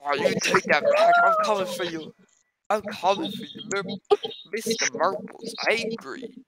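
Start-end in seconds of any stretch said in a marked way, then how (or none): 0.0-2.1 shrieking high and loud; furious high-pitched villain shriek; unhinged menace. Acted.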